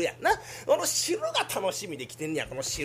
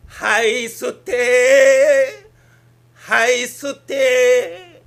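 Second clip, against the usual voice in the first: drawn out, then louder with every order; drawn-out breathe-in orders; 5 semitones higher, 13 dB louder, much slower than usual. Acted. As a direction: stretch each "吸って" longer and louder. Doctor repeats inhale orders.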